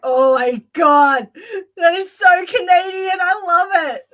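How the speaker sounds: delighted gush. Retold or imitated. Reacting to Canadian friend's greeting, gushing with delight. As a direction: gush over it with joy. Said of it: "And I'm like".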